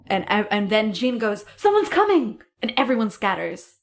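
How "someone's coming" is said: high, loud mock warning; acted alarm call. Jean warning the garden meeting, calling out in alarm.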